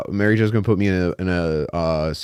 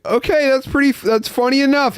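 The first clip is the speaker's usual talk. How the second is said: much higher, uneasy joke; nervous joking boyfriend; 13 semitones higher than usual. Retold or imitated. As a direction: laugh it off with rising nerves. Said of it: "he was like".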